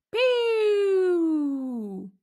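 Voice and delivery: high mouthed "pew" sound effect; a playful zap noise; power-down noise. Acted. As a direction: make the lights-out sound yourself. Power cuts out.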